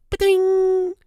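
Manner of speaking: high springy ba-ding; ping it like a cartoon bell; a silly high sound effect. Mimics the exhaust pipe.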